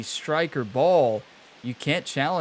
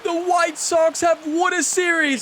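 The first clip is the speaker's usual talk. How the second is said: joyful cry; 13 semitones higher, 5 dB louder than usual. Real, not acted. Team wins after long drought.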